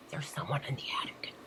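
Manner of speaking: whispered low, fast and secret; hushed whisper. Retold or imitated. Mother whispering to her daughter about the attic, hushed. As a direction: breathe it like a frightened secret. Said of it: "real quiet".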